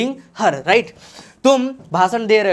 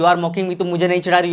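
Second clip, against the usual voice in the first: ordinary talk, nothing marked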